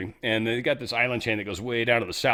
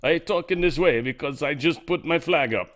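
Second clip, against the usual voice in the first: a put-on Russian accent, lightly done; hammed-up Russian speaker voice; mock Russian accent; 3 semitones higher, 4 dB louder than usual. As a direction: imitate the Russian clip, a bit louder. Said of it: "It sounds like that to me".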